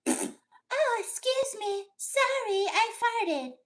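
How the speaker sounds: put-on very high girly falsetto, dainty; hammed-up high ladylike voice; feminine voice. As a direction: play a prim lady apologising sweetly. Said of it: "in your feminine voice".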